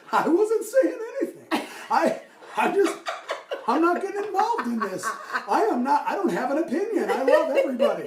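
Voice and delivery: high, loud, protesting impression voice; Tom Henry. Tom denying he said anything about wow, high protesting voice. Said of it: "working on my Tom Henry impression".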